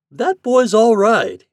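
high, loud, slow approval; emphatic, drawn-out praise; admiring captain. A narrator's reading. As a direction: declare your admiration heartily. Captain admiring boy to mate.

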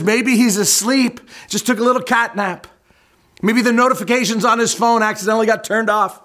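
mock them like a heckler; sarcastic mockery. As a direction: Elijah smack talking the prophets, high sarcastic ribbing. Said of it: "he starts smack talking".

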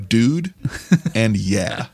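Texas dude. Shown as a demonstration. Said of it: "key to the accent".

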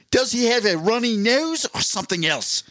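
high-pitched Australian host, wondering; Steve Irwin. Acted. Wildlife host examining a man's tissues, high-pitched Australian impression. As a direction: puzzle over the animal in an Australian accent. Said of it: "I don't think I can do the Australian accent".

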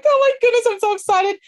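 high, loud gushing excitement; bursting with delight; so excited. Shown as a demonstration. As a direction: squeal it like you can't contain yourself. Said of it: "Be like".

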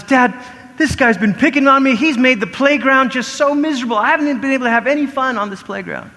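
put-on high-pitched aggrieved kid complaining to dad; mock upset child's plea; whining child. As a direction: pour out a kid's misery. Child complaining to parent about bully.

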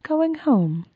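sing-song rhyming poem reading; rhyming recap. Acted. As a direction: read it like a playful verse. Reads rhyming episode summary.

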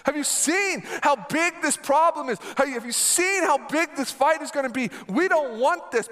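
mock-panicked cry to the others; panicked yelling. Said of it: "they're yelling at them".

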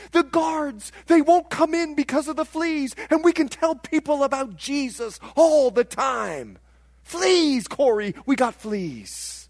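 high, loud, eager explanation; excited persuading. Retold or imitated. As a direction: imitate a sister bubbling over with good news. Betsy thrilled about the fleas.

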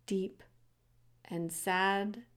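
ordinary delivery

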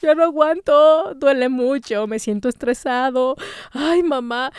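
imitando un lamento quejumbroso, un poco lento; queja lastimera. Retold or imitated. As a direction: finge quejarse como quien ya no aguanta. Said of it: "su monólogo de". (lamento quejumbroso medio actuado)

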